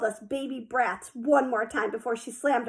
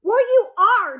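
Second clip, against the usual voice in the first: blunt retort; 10 semitones higher, 7 dB louder, slower than usual. A narrator's reading.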